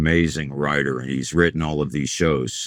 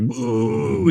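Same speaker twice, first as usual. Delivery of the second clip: long drawn-out mock boo, pitched up; imitated crowd boo; 6 semitones higher than usual. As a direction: boo like a hostile audience. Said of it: "the audience gave me the corn pretty bad".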